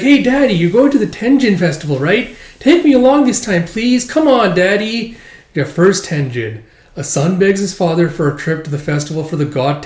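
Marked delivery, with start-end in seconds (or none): 0.0-5.2 put-on high, loud pleading little boy; whiny child voice. Son begging his father to take him to the festival, high whiny child. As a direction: beg like a small kid tugging at dad.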